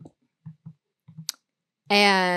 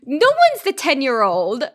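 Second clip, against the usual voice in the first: indignant correction; 11 semitones higher, 5 dB louder than usual. Retold or imitated. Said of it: "I'm like".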